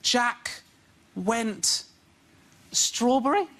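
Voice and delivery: put-on halting, high child reading; imitating son. Son reading aloud to dad, hesitant child voice. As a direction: sound out each word like a confused kid. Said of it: "when my son goes".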